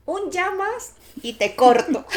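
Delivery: playful threat while laughing; laughing mock threat. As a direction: fake warning through laughter. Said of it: "Y me dijo".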